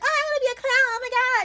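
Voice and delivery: high, loud, gushing fake-eager voice; mocking excited wannabe. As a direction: squeal it like an eager newbie. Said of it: "be like".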